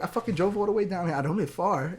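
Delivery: put-on heavy New York accent. Mother gripes about driving down, heavy New York accent. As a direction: complain indignantly as a mock New Yorker. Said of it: "she had a really heavy New York accent".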